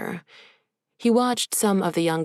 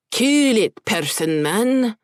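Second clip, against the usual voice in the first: Scottish brogue returning; 6 semitones higher, 5 dB louder, slower than usual. Acted. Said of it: "his brogue returning".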